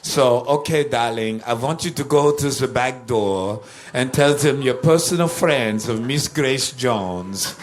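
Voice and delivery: put-on peculiar accent, part Dietrich, part Lugosi; [name]. Singer instructing the musicians by telephone, in her peculiar hybrid accent. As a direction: imitate a theatrical Dietrich-meets-Lugosi accent, drawing out the end. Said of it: "had a very peculiar accent. Uh, it was a cross between Marlene Dietrich".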